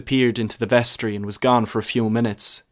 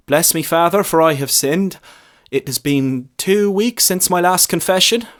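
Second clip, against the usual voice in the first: put-on high, louder young voice reciting; nervous lad; 8 semitones higher, 6 dB louder than usual. A narrator's reading. Lad beginning his confession to the priest.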